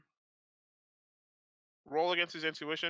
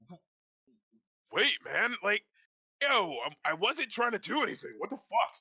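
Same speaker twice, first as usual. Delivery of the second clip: higher, flustered protest; defensive guard; 8 semitones higher than usual. Acted. Guard caught out, pleading his innocence. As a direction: stammer out a panicked denial. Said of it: "the guard is like".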